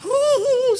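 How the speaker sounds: high sobbing gasp; a quick imitated sob; mock sob. Pastor imitating the woman's sob. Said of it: "nangis dia".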